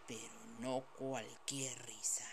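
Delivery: whispered narration, soft and eerie; spooky whisper. A narrator's reading. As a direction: breathe it like a ghost story. Narrator whispers spectral laugh.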